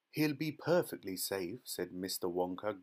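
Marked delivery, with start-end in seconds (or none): none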